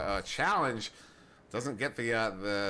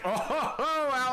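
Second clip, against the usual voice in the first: hearty laugh; 13 semitones higher, 3 dB louder, much slower than usual. Real, not acted. Caster laughing at a play.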